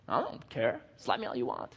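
low, soft, listless mumble; indifferent dead man. Acted. Dead man in the casket being slapped, indifferent murmur. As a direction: shrug it off quietly, past caring. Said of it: "'Cause dead men don't care".

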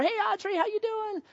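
high, sugary-sweet mom voice. Retold or imitated. Mom knocking for Audrey, high mom voice.

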